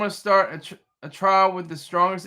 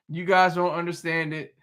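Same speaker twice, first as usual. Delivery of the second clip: usual speaking voice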